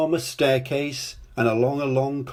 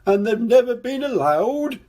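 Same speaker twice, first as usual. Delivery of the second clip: high, louder sobbing king; 11 semitones higher, 4 dB louder than usual. A narrator's reading. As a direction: sob through the whole line. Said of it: "he sobbed".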